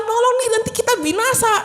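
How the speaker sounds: high mock panicky plea; panicked disciples. Disciples in the storm pleading, high mock panic. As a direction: urge him, we will perish. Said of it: "gitu kan".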